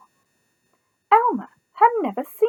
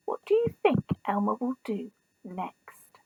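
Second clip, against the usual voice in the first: hushed, secretive ask; soft whisper; 3 semitones lower, 10 dB quieter than usual. Real, not acted. Reader whispering a question to the listening child. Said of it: "question to child listeners".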